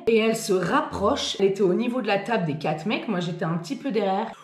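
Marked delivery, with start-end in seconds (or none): none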